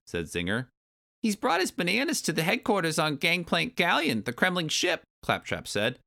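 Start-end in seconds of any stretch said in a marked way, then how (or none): 1.2-5.0 very high little creature voice; high-pitched tiny henchman explaining; small Kremling. Acted. Kremling telling the bee about the boss, high creature voice.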